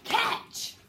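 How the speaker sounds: high, loud "Catch!"; slamming shout. Shown as a demonstration. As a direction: cry it out as fists slam down. Demonstrates shout with fists slammed.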